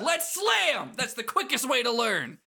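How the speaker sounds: high, hyped-up cry; over-the-top battle enthusiasm; loud game character. Acted. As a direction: call it out high and excited. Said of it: "I hope my neighbors can hear me".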